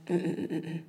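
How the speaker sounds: soft little string of disapproving hums; low-key mock director's dismissal; dismissive interruption. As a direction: hum a quiet no to cut them off. Director cuts off the audition.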